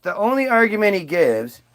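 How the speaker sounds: ordinary talk, nothing marked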